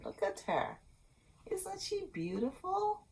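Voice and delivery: soft, high admiring voice; tender. Real, not acted. Storyteller showing children Rapunzel's picture, tender. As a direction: coo over the picture. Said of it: "showing the picture".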